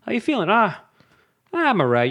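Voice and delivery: high, easy small-talk greeting; normal casual chat. Acted. Neighbours swapping pleasantries before an awful admission, casual. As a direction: play both sides of a breezy hello. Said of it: "a normal conversation".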